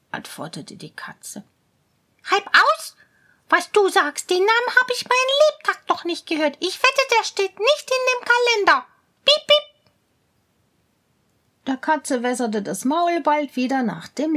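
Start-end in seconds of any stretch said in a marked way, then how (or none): 2.3-9.7 high, fast, louder mouse voice; astonished mouse. Acted. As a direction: chatter in disbelief like a mouse.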